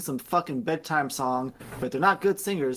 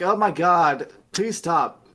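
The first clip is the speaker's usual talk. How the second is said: louder, raised mock pleading; mock exasperated plea; 3 semitones higher, 7 dB louder, slower than usual. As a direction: plead loudly, hammed-up. Player pleading in retold scene.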